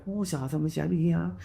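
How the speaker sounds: soft imitation of a Chinese-speaking woman; hushed put-on Chinese auntie voice. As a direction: mutter mock Chinese as the auntie.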